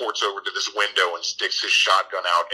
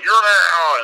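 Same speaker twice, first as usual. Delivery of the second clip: higher, louder snap; mock shouted dismissal; 10 semitones higher, 9 dB louder than usual. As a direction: bark it as the creepy lady. Lady throws him out.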